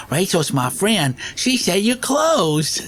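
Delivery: high, very slow and earnest; crazy prank caller voice. Retold or imitated. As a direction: drag it out like a confused caller. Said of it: "do crazy sounding stuff".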